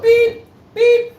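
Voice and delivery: high loud mock reversing beeps; truck beeping. Host mocking the British truck backing up, loud high beeps.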